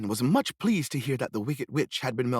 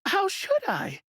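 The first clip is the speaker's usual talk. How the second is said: put-on girl's reply; slower than usual. A narrator's reading. Dorothy answers witch.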